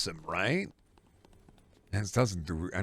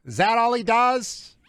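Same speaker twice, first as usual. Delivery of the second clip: much higher, louder and slow, a puzzled boss asking; side character's voice; 6 dB louder than usual. Retold or imitated. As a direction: draw out the question in a high, strange voice. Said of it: "This is the Patrick Warburton playing, uh, a side character in Twin Peaks".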